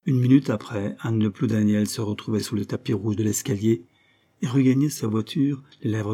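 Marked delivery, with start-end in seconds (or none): none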